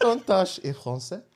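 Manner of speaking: high, soft comic French; French accent. Shown as a demonstration. Echoing the friend's attempt, French accent. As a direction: tease in a put-on French accent. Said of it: "That's French".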